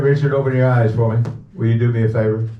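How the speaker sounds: normal talking voice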